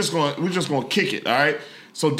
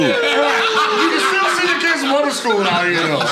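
laughing; 9 semitones higher, 3 dB louder than usual. Real, not acted. Laughing agreement.

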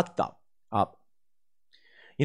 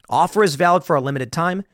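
upbeat sponsor read, slightly louder; 3 dB louder than usual. Acted. Host reading a sponsor ad to listeners, upbeat pitch. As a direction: sell the deal to listeners.

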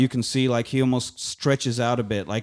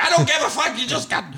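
put-on flustered drunk; 13 semitones higher than usual. Gideon yelling at bartender.

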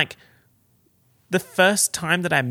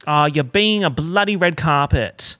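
loud, slow mock scolding; Aussie cursing cadence; 3 semitones higher, 7 dB louder than usual. Shown as a demonstration. Showing it sounds like an insult, loud mock scolding. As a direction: scold with a drawn-out "bloody". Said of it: "in my normal cadence".

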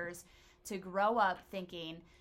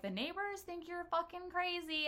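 high-pitched mock of an ex; mocking quote of ex; 11 semitones higher than usual. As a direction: imitate him in a much higher voice. Retold ex reacting during a fight.

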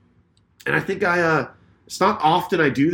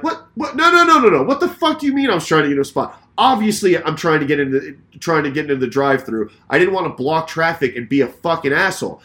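retold fuming; 3 semitones higher, 5 dB louder than usual. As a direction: cut off his excuse in re-enacted outrage. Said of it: "fucking fuming".

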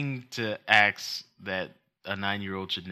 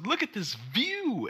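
put-on high, slow, dreamy child voice; slow and proud, like a little girl; higher and slower mock kid; mock kid showing off; 12 semitones higher, slower than usual. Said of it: "she goes".

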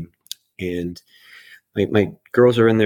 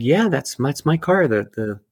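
higher, mildly retold insisting; pitched-up claim that the car is his; insistent protest; 6 semitones higher than usual. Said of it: "And I said".

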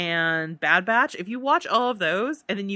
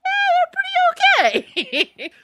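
squeaky high grudging approval; grudging laugh; 8 dB louder, slower than usual. Retold or imitated. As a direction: laugh it off, half-convinced. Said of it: "to being like".

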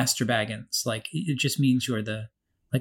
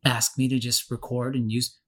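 ordinary talk

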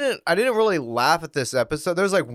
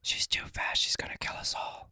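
ASMR whisper; 12 dB quieter than usual. Pretend. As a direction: panicky joke whisper. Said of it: "going all full A S M R here".

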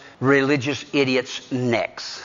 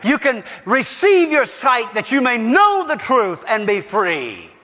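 rising, ringing pulpit cadence, each line pushed higher; preaching at full force; 8 semitones higher than usual. Acted. Preaching builds.

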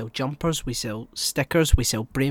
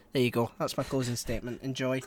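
ordinary delivery

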